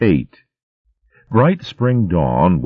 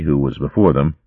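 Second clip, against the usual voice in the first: plain reading voice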